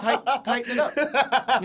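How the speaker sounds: slow, high, laughing demand; hammed-up laughing order; laughing mock command. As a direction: bark it out while cracking up.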